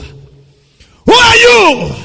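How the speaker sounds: put-on high, drawn-out old father's question; Isaac's bewildered question. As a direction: ask it slowly, stunned, up high. Isaac voiced, slow high question.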